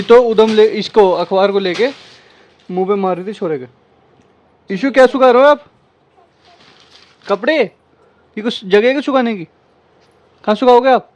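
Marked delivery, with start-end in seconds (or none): 4.7-11.1 teasing scold. Real, not acted. Mother teasingly scolds toddler Ishu.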